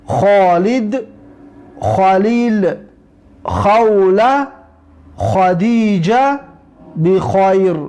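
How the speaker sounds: خالد, خلیل, خوله, خدیجه, بخیر said slowly and deliberately; repeat drill. Shown as a demonstration. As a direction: stretch خوله and خدیجه out, throat kh.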